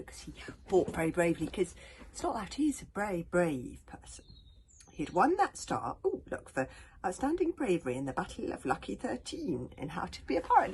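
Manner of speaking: lively enthusiastic aside; excited aside. Real, not acted. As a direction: burst out of the whisper with enthusiasm. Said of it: "excited aside about the book".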